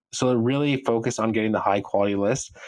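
ordinary delivery

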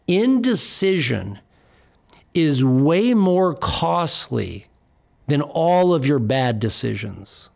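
slow, weighty "Indecision"; slow emphasis. Shown as a demonstration. Coach warning clients, slowly and weightily. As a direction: let each word land slowly. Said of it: "Get them on a sheet of paper".

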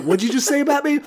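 high-pitched mock indignation; affronted Abner. Podcaster voices Abner confronting his accuser, affronted.

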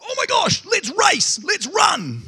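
high, loud, urgent cry to race off; aggressive excited shout. Retold or imitated. Preacher acting out the shepherds rushing to the baby, excited high shout. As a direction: shout it like you can't wait to run. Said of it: "real aggressive way of saying it".

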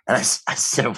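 normal talking voice

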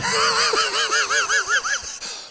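delighted high shriek of a laugh. Real, not acted. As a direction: let a wild shriek of laughter out. Laughs at cat joke.

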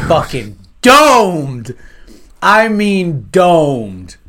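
"domed" drawn out long and loud; emphatic disbelief. Real, not acted. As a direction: punch and stretch the word "domed". Stressing shocking film death.

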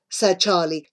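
ordinary delivery

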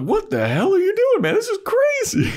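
very high, loud squeak of protest; squeaky zombie bro. Retold or imitated. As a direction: panic like a bro yanked out of a car. Zombie bro dragged from car.

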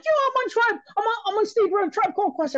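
very high thrilled yelling; can't believe it, yell it down the phone; ecstatic phone call. Retold or imitated. Fan phones friends at three am, yelling thrilled.

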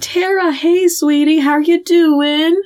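slow, high, mock-sweet cooing; syrupy coaxing. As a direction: imitate a director humouring an actress. Director sweet-talks upset actress.

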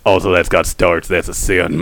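very low, hushed Sling Blade voice. Acted. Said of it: "redneck Aramaic".

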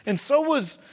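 loud, high, building sermon delivery; full-force preaching. Real, not acted. Preacher challenging those who claim God's power, impassioned preaching. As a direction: drive each example home, rising in pitch and force.

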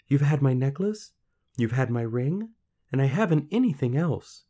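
high, loud pleading; distressed girl. A narrator's reading. Girl to little man, desperate. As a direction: protest helplessly in a girl's voice.

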